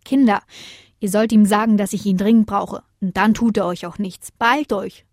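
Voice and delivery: ordinary delivery, nothing marked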